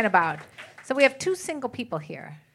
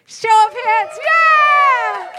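hype shout; 12 semitones higher, 8 dB louder, much slower than usual. Real, not acted. Host hyping up the crowd.

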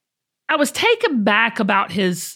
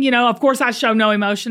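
ordinary talk, nothing marked

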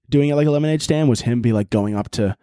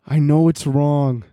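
ordinary talk

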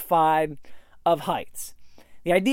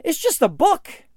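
dismissive shrug; 9 semitones higher than usual. Retold or imitated. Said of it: "he said".

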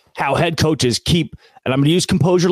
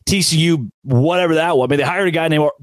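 usual speaking voice

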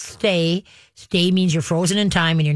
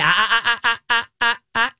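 higher, repeated warning ahs; corrective "ah-ah-ah"; 6 semitones higher than usual. Shown as a demonstration. Trainer stops dog running past, higher warning ahs. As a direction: fire off ahs to halt the dog.

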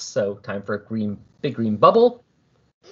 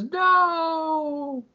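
long high wail of no; anguished cry. Retold or imitated. Kyle watching the village explode, long high anguished no. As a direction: cry out in despair as the village burns. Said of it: "Kyle gets very upset and says".

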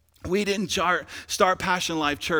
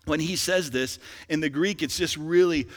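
normal talking voice

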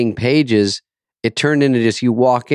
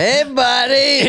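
high, slow cartoonish dub voice; squeaky dubbed David Spade; German dub voice; 3 dB louder, slower than usual. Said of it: "a German David Spade that does my stuff".

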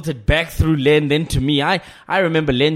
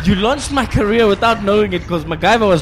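laughing, higher and louder thanks; 6 semitones higher, 5 dB louder than usual. Real, not acted. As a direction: laugh through the thank-yous. Laughing gratitude to the listener.

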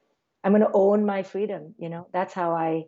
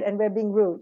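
ordinary delivery

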